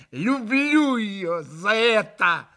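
a wild, strangled love-hate; distorted voice. A narrator's reading. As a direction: let the voice warp with fury. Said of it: "искажённым голосом прибавила Катя".

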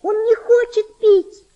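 put-on high, loud, slow despairing girl's cry; desperate shout. Girl shouting to mother that the boy won't drink, desperate. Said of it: "с отчаянием закричала девочка".

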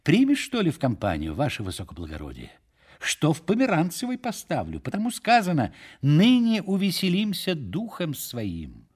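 high, swooping tavern cajoling; jovial Фомушка inviting. A narrator's reading. Фомушка asks the major to share a drink, jovial cajoling. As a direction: coax him grandly into drinking along.

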